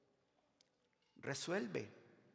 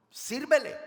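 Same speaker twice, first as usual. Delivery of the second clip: loud, higher shout; shouted command; 9 semitones higher, 12 dB louder than usual. Acted. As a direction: cut off the excuse with a shout. Answers imagined excuse again. (grito de mandato, más alto y agudo)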